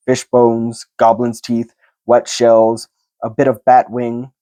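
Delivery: plain reading voice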